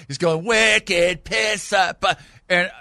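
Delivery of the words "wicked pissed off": slow, drawn-out, high fake Boston accent. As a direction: ham up a Boston accent, very slowly. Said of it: "doing all these fake Boston accents".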